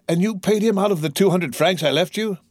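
put-on high, incredulous young son; appalled. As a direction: question a father in growing dismay. Son appalled at father's payment.

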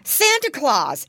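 loud, high, drawn-out cut-in; interrupting excitedly. A narrator's reading. Brother finishing piglet's sentence, excited interruption. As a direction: burst in with the name, stretching it. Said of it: "interrupted Curly Tail".

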